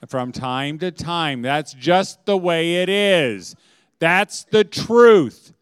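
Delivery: slow, building to loud insistence; emphatic declaration. Real, not acted. Preacher assures moms all marriages struggle, emphatic declaration. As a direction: drive each phrase home, drawing it out. Preacher drives the point home.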